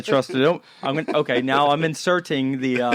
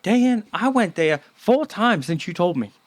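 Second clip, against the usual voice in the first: higher-pitched mimicked drawling accent, played for laughs; Wanda Sykes accent; 6 semitones higher than usual. Podcaster quoting his neighbor about the taco place, Wanda Sykes accent. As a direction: put on a comic impression of the neighbor's accent. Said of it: "with her Wanda Sykes accent".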